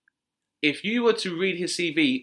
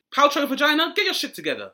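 incredulous outburst; 12 semitones higher, 5 dB louder than usual. Retold or imitated. Clerk's incredulous jab.

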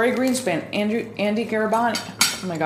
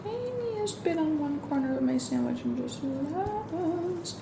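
hushed; 6 semitones higher, 9 dB quieter than usual. Real, not acted. Sneaking sugar onto sandwich.

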